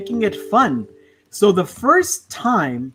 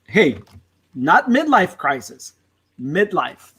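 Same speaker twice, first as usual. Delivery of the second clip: usual speaking voice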